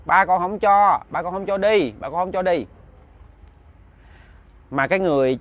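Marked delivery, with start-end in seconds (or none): 0.1-2.7 put-on child protesting loudly. Possessed child protesting at night.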